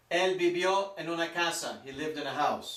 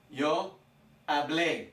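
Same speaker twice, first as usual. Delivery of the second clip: "hablé" with the final é stressed; teacher demo of "hablé", stressed at the end; stress on last syllable; slower than usual. Shown as a demonstration. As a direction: land the emphasis on the -blé of "hablé". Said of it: "the emphasis is on the last syllable".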